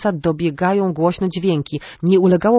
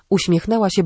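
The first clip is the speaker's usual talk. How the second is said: ordinary delivery, nothing marked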